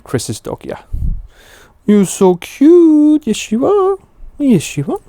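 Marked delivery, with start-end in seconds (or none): 1.9-5.0 much higher and louder, slow, cooing at the dog. Real, not acted. Streamer cooing at the friend's dog on camera, high baby talk. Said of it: "hearing [name]'s dog voice today".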